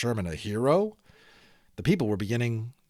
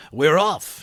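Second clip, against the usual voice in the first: louder, higher cry of departure; shout; 5 semitones higher, 6 dB louder than usual. A narrator's reading. Inventor shouting to the driver as they set off. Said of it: "he shouted".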